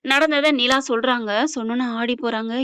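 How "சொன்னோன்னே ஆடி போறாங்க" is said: ordinary delivery, nothing marked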